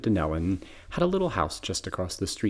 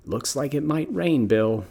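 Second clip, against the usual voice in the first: lightly raspy grandmother, pitched up; a raspy old grandma; 7 semitones higher, 3 dB louder, slower than usual. Retold or imitated. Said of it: "my grandmother in her raspy voice saying".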